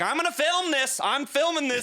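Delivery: high-pitched mock indignation; imitated agitated dad. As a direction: mimic a worked-up dad with a camera. Imitates dad jumping out filming.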